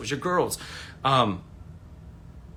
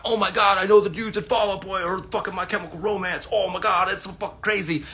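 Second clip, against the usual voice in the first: mock gushing; 7 semitones higher, 3 dB louder than usual. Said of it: "My life is not".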